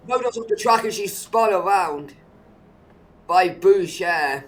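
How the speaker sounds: excited. Real, not acted. Crash seen live.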